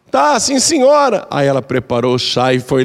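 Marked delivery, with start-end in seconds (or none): 0.1-1.3 nervous daughter-in-law. Retold or imitated.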